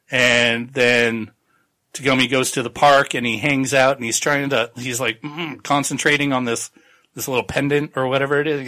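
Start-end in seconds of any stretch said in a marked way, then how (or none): none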